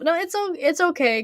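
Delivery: ordinary delivery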